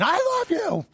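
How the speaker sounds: shrill-free full-throated shout of love; screaming. Retold or imitated. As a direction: yell it out like you can't hold it in. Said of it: "kinda like somebody screaming".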